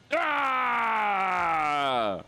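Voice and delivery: high frightened shriek; startled scream. Real, not acted. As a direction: scream as the scare hits. Second jump scare.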